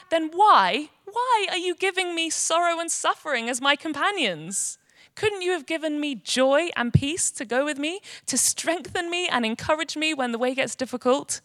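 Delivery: high, loud, distraught pleading; frightened, aggrieved cry to a master; Much Afraid. Acted. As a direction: plead in acted horror and dismay. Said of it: "She starts trembling, and she gasps".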